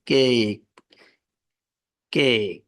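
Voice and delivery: কেক with a slightly stretched vowel; long vowel cake. Shown as a demonstration. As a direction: draw out the vowel in কেক a little. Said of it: "উচ্চারণ একটু long হইতেছে". (কেক একটু লম্বা উচ্চারণে)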